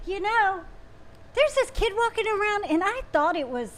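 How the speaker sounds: imitating her earnest report, pitched high; serious, earnest impression. Retelling Joanna's mistaken sighting to the co-host, in mock earnest. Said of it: "she was so serious".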